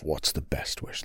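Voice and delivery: unvoiced whisper to himself; thinking aloud under his breath; murmured to himself as he ponders; whispered musing.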